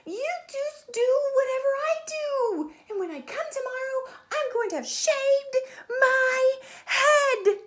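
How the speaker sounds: high, loud scolding shout; high angry shouting girl; furious yell. Acted. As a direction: scream it at the copycats. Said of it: "Stephanie yelled".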